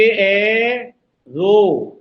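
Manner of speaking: एरो stretched as ए, pause, रो; slow split of एरो; syllable by syllable. Shown as a demonstration. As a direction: draw out both halves of एरो separately. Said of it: "ये तीन सब syllable से बना".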